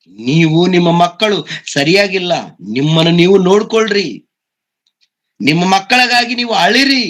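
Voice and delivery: high, urgent voicing of Jesus' words; impassioned preaching. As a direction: proclaim it from the pulpit. Preacher voices Jesus' warning. (ಉತ್ಕಟ ಪ್ರವಚನ ಧ್ವನಿ)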